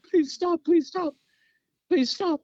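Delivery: high, loud begging in imitation; mock desperate pleading. Tortured captive to his attackers, mock pleading. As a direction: beg for mercy in a squealed mock plea.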